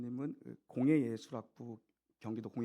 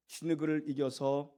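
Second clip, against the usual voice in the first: normal talking voice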